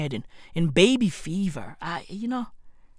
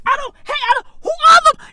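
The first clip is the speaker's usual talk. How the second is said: baffled shout; 8 dB louder, faster than usual. Acted. Baffled rant at mystery group.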